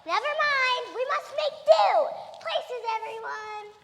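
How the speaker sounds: high, loud frantic shout; panicked shouting; panicked excitement. Acted. Panicked rush at early arrival.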